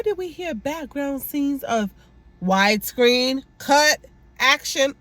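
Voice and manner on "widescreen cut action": slow, loud mock director calling cut and action; imitating a director shouting cut; mock director calls. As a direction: drawl it out like a film director on set. Mocking director's on-set calls.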